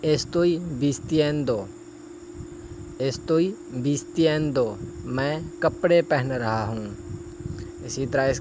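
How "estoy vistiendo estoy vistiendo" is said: slow, careful Spanish, each syllable clear; slow model phrase. Shown as a demonstration. Teacher modelling a Spanish phrase for students, slow and careful. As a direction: model it slowly for learners. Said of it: "آگے".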